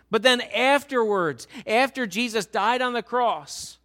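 fervent sermon delivery; full-force preaching. Acted. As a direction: press the congregation with rising intensity.

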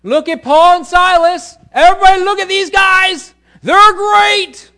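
full-force high-pitched shout; crying out. Acted. Slave girl drawing crowds to Paul and Silas, shouting. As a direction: holler it to the whole street like a crier.

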